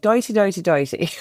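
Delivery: playful Irish practice word, first ones lifted; Irish accent warm-up. Shown as a demonstration. Guest demonstrates her Irish warm-up, playful put-on Irish accent. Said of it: "for Irish, I do, like".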